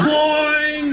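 high, loud imitated spring; short cartoon boing. Zebedee bouncing in to interrupt, high comic boing.

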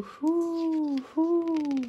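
high hooting wind. Acted. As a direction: hoo like the wind. Wind sound in story.

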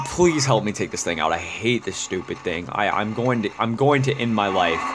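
ordinary talk, nothing marked

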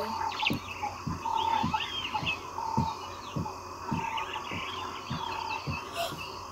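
sharp gasp. Acted. Sees a cheetah zoom by.

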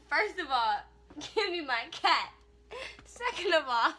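loud, emphatic retold telling-off; acted scolding. Owner retells demanding her cat back, loud mock scolding. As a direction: lay down the points loudly, half amused.

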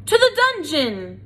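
high, loud jeering cry; crowd cry. A narrator's reading. A mouse in the crowd demands the dungeon, loud cry. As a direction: call for his punishment. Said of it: "a voice cried out".

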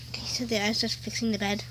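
hushed quick whisper. Real, not acted. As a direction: say it under your breath. Whispered aside while fixing.

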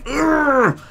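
high, strained roar; imitated motivator growl. Imitating the bloke in the video, a high strained noise. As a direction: let out a mock straining yell.

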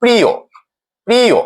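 প্রিয় ending in a clear "o"; pronunciation demo. Shown as a demonstration. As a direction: say প্রিয় as "priyo", falling on the last vowel. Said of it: "সেটি ও এর মতো".

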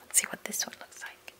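whispered, secretive aside; hushed curiosity. A narrator's reading. Reader lifting a flap to show children, whispering. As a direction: whisper while peeking at it.

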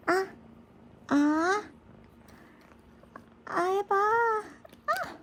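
high coaxing pet voice; talking to a dog. Real, not acted. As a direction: coax it up high. Coaxing pet to open mouth.

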